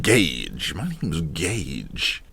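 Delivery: low, slow tough-guy drawl on Gage; rebel voice. Pretend. Joke dialogue, the rebel Gage Carney introducing himself in a low tough voice. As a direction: play it like a moody rebel. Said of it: "he sounds like a rebel".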